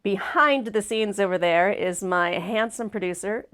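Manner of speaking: normal talking voice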